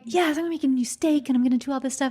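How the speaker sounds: hammed-up go-getter; eager cook. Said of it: "I'm like".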